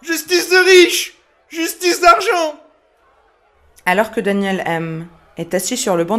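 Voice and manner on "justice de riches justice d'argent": high, loud shout of outrage, words drawn out; enraged yell. A narrator's reading. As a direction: yell it slowly like a furious father in court. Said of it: "laisse exploser sa rage et hurle".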